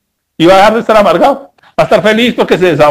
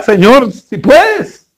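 hesitant plea, higher and drawn out; stretched, higher-pitched mock indecision; indecisive plea; 4 semitones higher, much slower than usual. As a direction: plead it slowly with wavering resolve. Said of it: "Está indeciso, ¿cierto?".

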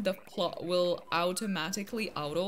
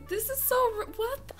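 stunned disbelief; 10 semitones higher than usual. Real, not acted. Plot sold again.

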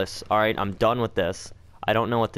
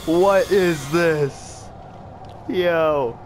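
higher, louder, slow rattled voice; shaken and loud; 6 semitones higher, 4 dB louder, much slower than usual. Real, not acted. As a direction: still reeling from the scare. After being chased.

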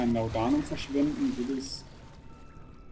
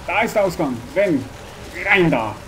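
loud, higher frightened calls; panicked shout; 4 semitones higher, 5 dB louder than usual. Real, not acted. Player fleeing monster yells directions in fear.

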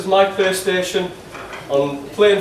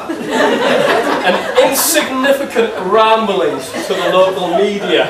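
put-on Geordie accent; 2 semitones higher, slower than usual. Angry fan text to Shola in a broad Geordie accent. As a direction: keep the thick Geordie accent, nagging the striker. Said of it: "in my strongest Geordie accent".

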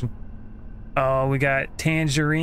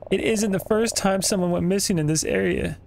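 higher-pitched character read; searching brother's voice; 5 semitones higher than usual. Acted. Line read in the customer's voice by mistake, higher role voice. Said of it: "Oh, I messed it up".

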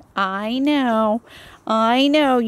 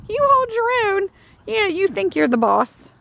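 high, sing-song cooing at a dog; baby talk to a puppy; 8 semitones higher than usual. Acted. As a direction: gush over the sweet puppy. Cooing at Zoe.

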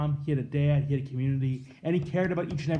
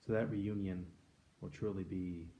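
quiet, prayerful petition fading softer to the end; closing prayer; 5 semitones lower, 8 dB quieter than usual. Acted. Priest leading the congregation in closing prayer, hushed and fading. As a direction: pray gently, letting the voice sink away.

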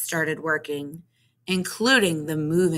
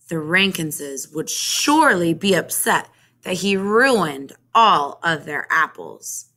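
usual speaking voice

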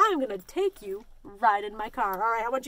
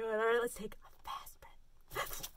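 whispering; 4 semitones lower, 12 dB quieter, slower than usual. Real, not acted. Breathing exercise with kids.

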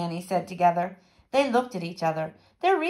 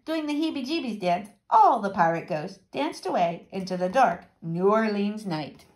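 plain reading voice

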